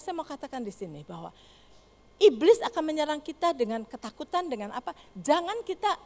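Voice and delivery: ordinary delivery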